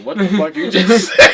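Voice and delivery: breaking into laughter. Real, not acted. Cracks up at absurd argument.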